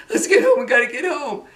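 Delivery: high, laughing urgent plea; hammed-up frightened urging; mock panic. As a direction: act out a frantic dash, half laughing. Acting out panicked run home.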